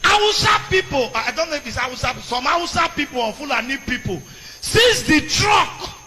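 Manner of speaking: loud, high outraged sermon; fiery preaching. Acted. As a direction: rail at the governor from the pulpit.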